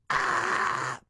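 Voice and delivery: very high "ah" with the throat slightly closed; raspy high "ah". Shown as a demonstration. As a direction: roll the phlegm through a high "ah". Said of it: "you're gonna do the, ah".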